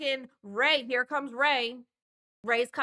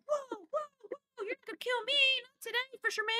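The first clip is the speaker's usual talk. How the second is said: mock terrified girls; 13 semitones higher than usual. Girls cornered by the killer, squeaky mock panic. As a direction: squeal it like a cornered teen in a slasher.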